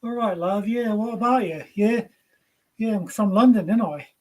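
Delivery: chirpy Cockney impression; Cockney accent. Shown as a demonstration. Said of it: "wants you to do a Cockney accent".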